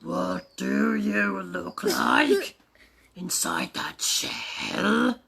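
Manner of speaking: slow, drawn-out tender question; loving. Shown as a demonstration. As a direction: coo it slowly and lovingly to the egg. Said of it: "That sort of loving".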